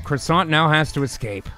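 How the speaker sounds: usual speaking voice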